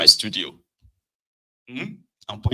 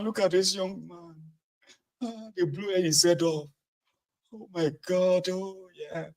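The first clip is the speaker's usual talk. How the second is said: high, loud keening lament; hammed-up lament, much higher; mock wailing mourner; 8 semitones higher, 6 dB louder, slower than usual. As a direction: wail like a grieving mother at a burial. Mock mourning mother over corpse.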